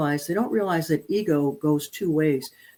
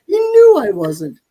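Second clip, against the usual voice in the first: emphatic outburst; 4 semitones higher, 8 dB louder, slower than usual. Real, not acted. Dog defied her.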